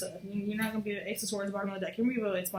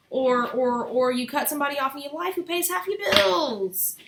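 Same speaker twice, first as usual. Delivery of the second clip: loud ranting; 6 semitones higher, 7 dB louder than usual. Real, not acted. Fire sign calling out fellow fire signs' impulsiveness, loud rant. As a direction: call them out, loud and pitched up.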